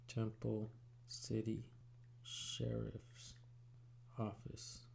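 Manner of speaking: soft, slow reading to oneself; absent-minded murmur. Real, not acted. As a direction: read it quietly to yourself, word by word. Reading to himself while searching.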